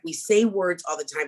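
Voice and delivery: ordinary talk, nothing marked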